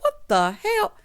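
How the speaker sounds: normal talking voice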